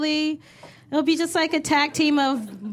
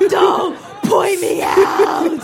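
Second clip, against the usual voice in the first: loud, high, drawn-out protest; mock mortified shout; 6 semitones higher, 6 dB louder, much slower than usual. Friend in the audience hates being named, hammed-up mortified shout. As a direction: yell slowly as someone singled out in a crowd. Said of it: "Her face is like".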